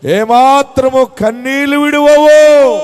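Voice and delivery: loud, high, long-held preacher's cry; triumphant proclamation. Real, not acted. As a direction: proclaim it slowly at full force, stretching the last word. Climactic proclamation of the verse.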